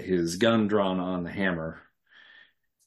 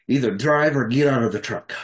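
louder, forceful order; acted threat; 5 dB louder than usual. Gunman pointing his gun at the driver, acted threat. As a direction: play a gunman giving an order.

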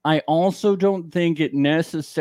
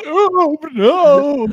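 high squealing no, louder; mock horror; 13 semitones higher, 4 dB louder than usual.

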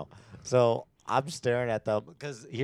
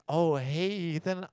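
higher-pitched, imitated hello; retold bright greeting; 8 semitones higher, slower than usual. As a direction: greet someone you just recognized, pitched up. Retold greeting on recognizing him.

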